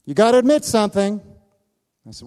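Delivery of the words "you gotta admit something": insistent. Retold or imitated. Old man insists.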